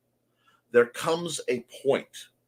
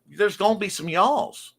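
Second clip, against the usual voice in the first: higher-pitched touch of a Southern accent; 6 semitones higher than usual. Shown as a demonstration. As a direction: lightly put on a Southern accent. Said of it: "southern accent".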